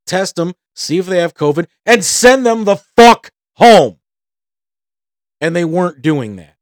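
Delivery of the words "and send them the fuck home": slow, heavy and much louder; fed-up outburst against the hospital; angry burst. Real, not acted. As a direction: hammer out the demand in frustration. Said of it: "I'm getting mad".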